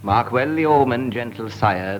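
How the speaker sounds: usual speaking voice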